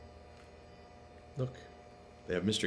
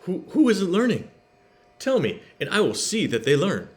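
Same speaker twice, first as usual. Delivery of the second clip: worried; 8 semitones higher, 5 dB louder than usual. A narrator's reading. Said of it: "He looked very worried".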